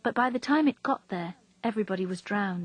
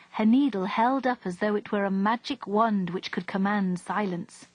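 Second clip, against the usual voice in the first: ordinary delivery, nothing marked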